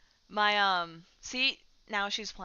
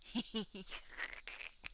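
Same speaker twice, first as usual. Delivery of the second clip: soft, airy giggle; quiet mischievous giggle; 2 semitones higher, 10 dB quieter than usual. Real, not acted. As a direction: laugh softly while teasing a pet. Laughing while grabbing cat's whiskers.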